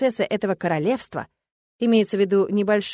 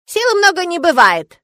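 put-on high, louder grumbling old man; 10 semitones higher, 9 dB louder than usual. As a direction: grumble at his cleverness. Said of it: "проворчал старик".

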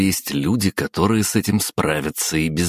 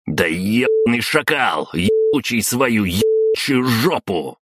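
higher, slowing angry outburst; full-throated cursing; 6 semitones higher than usual. A narrator's reading. Count cursing over reports on his clan's affairs, angry outburst. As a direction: swear like a dock loader, rising in pitch. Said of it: "Во всё горло выругался граф".

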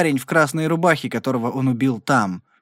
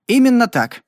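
high, firm confirmation; Rowdy standing by his story, resolute; 10 semitones higher, 5 dB louder than usual. A narrator's reading. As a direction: plant your feet and affirm. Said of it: "решительно подтвердил Рауди".

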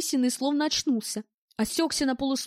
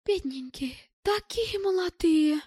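higher, drawn-out pity; pitying exclamation; 6 semitones higher, slower than usual. A narrator's reading. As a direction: exclaim it slowly over the poor young things. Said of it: "воскликнула круглоглазая".

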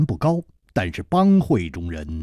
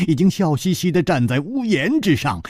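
usual speaking voice

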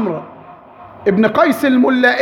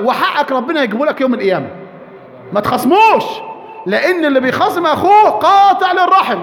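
ordinary talk, nothing marked

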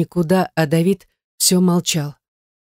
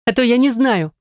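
higher-pitched stubborn retort; stubborn snort; 7 semitones higher than usual. A narrator's reading. She snorts back at his claim, stubbornly. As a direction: toss it back, stubbornly dismissive. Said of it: "фыркнула она упрямо".

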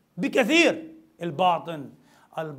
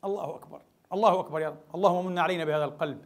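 ordinary talk, nothing marked

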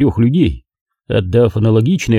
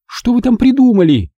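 anxious Margo; 13 semitones higher than usual. A narrator's reading. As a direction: demand to know. Margo pressing.

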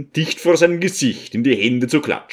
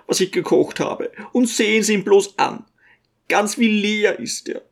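put-on high, fussing old mother, much higher; a fretting little old woman doting on her son; old mother's voice; 9 semitones higher than usual. A narrator's reading. Old mother fussing over her son to the guests, high-pitched.

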